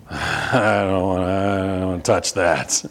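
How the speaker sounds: low, slow, drawn-out reluctance; squeamish reluctance. Retold or imitated. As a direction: drag it out like a kid dreading raw meat. Voicing reluctant kids facing raw meat.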